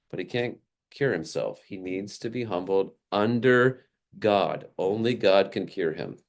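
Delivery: ordinary talk, nothing marked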